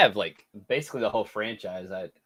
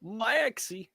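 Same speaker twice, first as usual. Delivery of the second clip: much higher, soft cartoon dog voice; Goofy; 12 semitones higher, 4 dB quieter than usual. Shown as a demonstration. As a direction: call out like Goofy to his son. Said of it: "I got Goofy".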